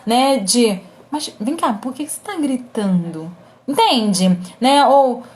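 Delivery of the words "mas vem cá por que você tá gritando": soft, disarming question; gently hushed challenge; lowered voice. Shown as a demonstration. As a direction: ask quietly to break the tension. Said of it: "um volume de voz mais baixo".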